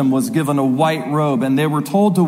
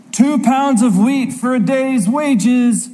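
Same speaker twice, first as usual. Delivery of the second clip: merchant yelling out; 5 semitones higher than usual. Acted. Merchant among famine prices, calling out his wares. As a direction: hawk the prices in a measured mock yell. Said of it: "a merchant yelling out".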